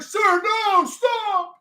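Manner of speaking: high-pitched desperate plea; panicked plea. Pretend. Streamer voicing an aide pleading with the president, high voice. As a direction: beg him to stop.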